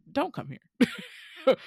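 high, slow drawn-out warning; playful warning to Korean stars; mock warning. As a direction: act out a wary local shooing visitors off.

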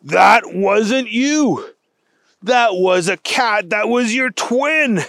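slow, emphatic storybook amazement; dawning realization. Acted. Wendell realizing there are two cats, dawning realization. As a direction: work it out aloud, drawn out. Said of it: "Special Agent Wendell Blaze realized".

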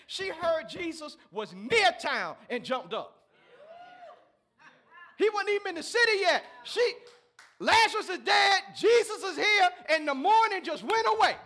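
loud, building preacher's cry; rising preacher shout, laughing in the middle; preaching at full force. Real, not acted. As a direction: shout it out like the Sunday climax.

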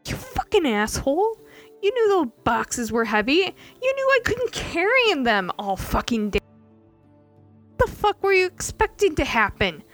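high, loud shouting rant; furious yelling. Acted. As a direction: let her rage spill out at him. Ilya yells at Archmay.

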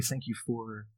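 soft, intimate prayer voice; hushed, sincere thanks to God; quiet prayer. Real, not acted. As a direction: pray humbly and quietly. Leads closing prayer.

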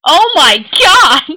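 drawn-out, high, loud outburst; real exclamation, high and slow. Real, not acted. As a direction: cry it out loud, stretching the words. Embarrassed reaction to uncomfortable term.

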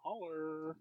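stretched rapper catchphrase, put-on voice. Teasing friends with a drawn-out rapper imitation. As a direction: drag out a rapper's shout-out. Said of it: "Oh, shut up, [name]".

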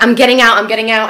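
loud, insistent acted shout; retold panicky outburst; urgent shouting. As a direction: yell it twice, demanding out. Acted threat to leave moving car.

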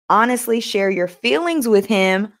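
normal talking voice

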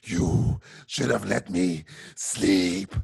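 very slow, low villain drawl; deep menacing movie villain; Cumberbatch Khan voice. Retold or imitated. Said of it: "mortal words of Benedict Cumberbatch".

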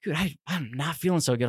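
mildly uneasy and subdued; queasy retold aside. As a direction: say it quietly, as if feeling sick. Retelling growing queasy at blood draw.

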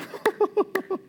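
high mock evil cackle; Satan's cackle. Pastor voicing Satan laughing at a careless believer, high mock cackle. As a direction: cackle like the devil sizing up prey. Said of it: "Satan looks at you and goes".

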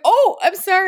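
imitating a flustered, shocked apology; mock shocked apology. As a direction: replay her startled apology. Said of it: "You looked so shocked".

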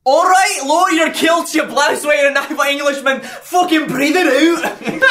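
loud, high mock Scottish meditation guide yelling; Gregor's Scottish accent. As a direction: bark it like an angry Scottish guru, ending slow with a laugh. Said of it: "How would Gregor say it?".

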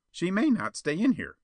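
high-pitched schemer's voice; suggesting. A narrator's reading. Perona suggests a risk to Spawn, doubtful. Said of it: "Perona suggested".

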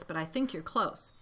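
ordinary delivery, nothing marked

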